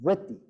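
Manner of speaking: "vritti" with a syllabic R vowel; Sanskrit vritti. Shown as a demonstration. Teacher modelling Sanskrit pronunciation of vritti. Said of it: "It's a R".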